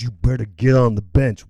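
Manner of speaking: low, firm coach's order; mock stern coach. Acted coach orders player off.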